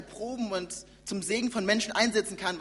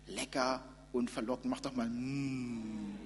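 seductive whisper; 7 semitones lower, 7 dB quieter, slower than usual. Acted. Tempting voice of serpent.

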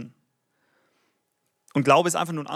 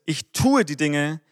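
"tue" stressed hard and drawn out; Betonung auf tue; 3 dB louder, slower than usual. Shown as a demonstration. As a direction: lean slowly on the word "tue". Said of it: "Betonung ist hier auf ich tue". („tue“ gedehnt und deutlich betont)

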